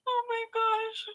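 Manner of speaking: high squeal while laughing; cracking up, words stretched thin and high; helpless laughter. Real, not acted. Cracking up at the texts.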